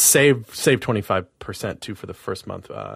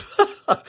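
high squeal laugh; 4 dB louder than usual. Real, not acted. Laughs at own spooky voice.

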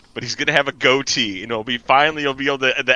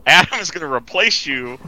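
ordinary talk

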